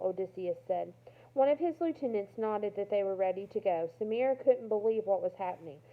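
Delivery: ordinary delivery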